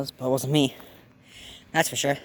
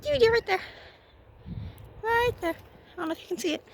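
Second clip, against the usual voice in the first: pet voice. Real, not acted. To the meowing cat, very high squeaky mock scolding.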